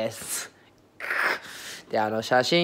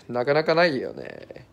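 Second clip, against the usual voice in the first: usual speaking voice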